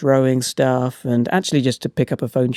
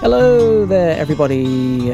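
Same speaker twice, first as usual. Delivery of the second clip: big hello; 4 dB louder than usual. Real, not acted. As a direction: belt out the opening hello. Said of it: "I never know how to get the first hello right".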